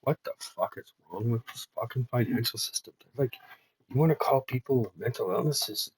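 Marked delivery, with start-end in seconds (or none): none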